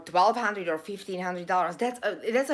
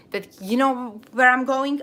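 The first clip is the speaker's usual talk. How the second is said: ordinary talk, nothing marked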